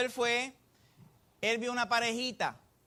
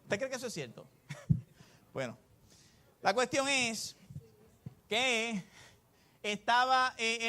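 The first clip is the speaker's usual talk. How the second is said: normal talking voice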